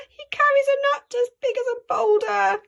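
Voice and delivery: high, fast, with gasps; frightened child. Acted. Child sees the huge shadow, high fast fear. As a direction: rush it out in rising alarm.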